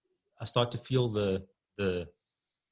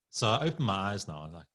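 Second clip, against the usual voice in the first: ordinary talk, nothing marked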